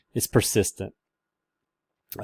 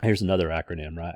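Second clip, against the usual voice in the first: ordinary talk, nothing marked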